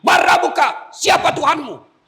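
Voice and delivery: high, loud shout; thundering interrogation. Acted. Angel questioning the dead in the grave, thundering shout. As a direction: demand answers like a stern grave angel.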